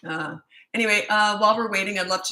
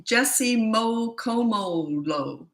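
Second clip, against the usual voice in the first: very slow, stretched-out "Mokomolo"; sounding out slowly; 3 semitones higher, much slower than usual. Real, not acted. Host reading an unfamiliar viewer name, sounding it out slowly. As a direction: draw out each syllable of "Mokomolo".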